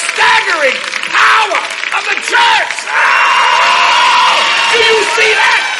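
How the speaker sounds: high, loud, impassioned preacher shout; full-force preaching. Acted. Preacher proclaiming the church's staggering power, shouted preaching. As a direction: build to a roaring pulpit climax.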